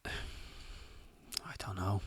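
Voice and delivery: whisper. Real, not acted. Unsure answer, dropped to whisper.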